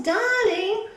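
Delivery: high, loud desperate call. Acted. Wife calling her husband in the flood, desperate cry. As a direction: call for him across the water. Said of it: "when she calls".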